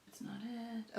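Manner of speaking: whispered aside to self; soft unvoiced whisper. Real, not acted. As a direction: mutter it under your breath. Searching for a name.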